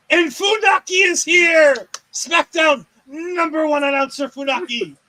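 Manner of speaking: loud, high drawn-out ring-announcer call; announcer hype. Pretend. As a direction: announce a big entrance with mock fanfare. Hyping an announcer's arrival.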